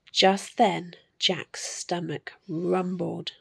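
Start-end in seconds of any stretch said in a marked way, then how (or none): 1.2-3.3 hushed whisper. A narrator's reading. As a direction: breathe it as a secret. Whispered aside.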